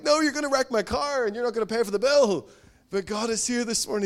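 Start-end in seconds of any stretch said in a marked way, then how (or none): 0.0-2.5 panicked parent in the car. Retold or imitated. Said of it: "You're like".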